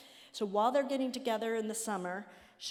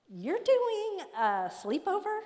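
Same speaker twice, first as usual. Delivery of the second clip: amazed disbelief; 11 semitones higher, 5 dB louder, slower than usual. Retold or imitated. Friend calls, amazed at sleepover.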